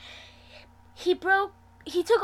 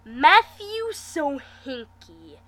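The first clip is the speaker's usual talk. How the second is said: growl; 2 semitones higher, 7 dB louder, much slower than usual. Acted. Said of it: "Wes growls".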